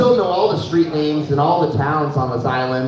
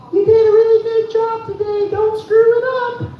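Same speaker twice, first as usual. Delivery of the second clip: high sung encouragement, much higher; sing-song angel voice. Pretend. Planet Fitness on his shoulder, sung high and sweet. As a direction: croon it like a tiny shoulder angel. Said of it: "whispering in my ear".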